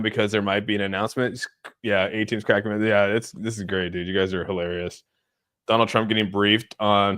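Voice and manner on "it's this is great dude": ordinary talk, nothing marked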